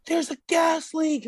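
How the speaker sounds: high, slowed mock caller voice; mock alarmed caller. Callers reporting a gas leak to the technician, mock alarm. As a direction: pitch it way up, slowly, imitating a caller.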